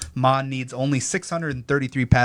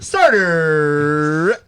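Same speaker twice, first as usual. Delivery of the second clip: stretched, louder announcer echo; hype callout; 2 semitones higher, 7 dB louder than usual. Acted. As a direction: drag it out like an intro sting. Echoing the show intro callout.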